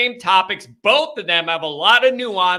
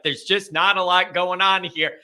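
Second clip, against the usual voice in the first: usual speaking voice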